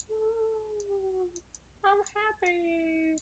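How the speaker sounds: very high, slow happy voice; happy cartoon squeal. Elephant feeling magic happen, very high slow happy coo. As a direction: float it up high and delighted.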